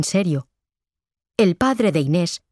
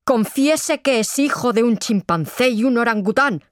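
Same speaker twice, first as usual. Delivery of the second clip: high-pitched insistent order; forceful interrogator's cry; shouted demand; 6 semitones higher than usual. A narrator's reading. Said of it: "gritó el padre de Inés".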